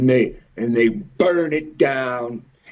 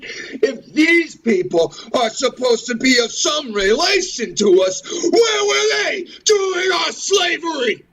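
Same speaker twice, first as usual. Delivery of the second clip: anguished cry. Real, not acted. Demanding where they were during slavery, crying out. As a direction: cry it out, pained and outraged. Impassioned rant about slavery, voice cracking.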